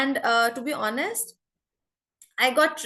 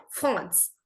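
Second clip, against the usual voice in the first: "France" with a French R and nasal vowel; French-accented "France", R nearly swallowed; 2 semitones higher, 4 dB louder than usual. Shown as a demonstration. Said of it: "they say France".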